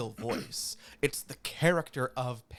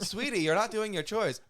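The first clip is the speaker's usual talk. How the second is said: raised, playful nagging mother voice; mock scolding mother; 8 semitones higher than usual. Mother scolding her child about chores, playful mock nagging. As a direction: nag a kid about chores, mock-stern.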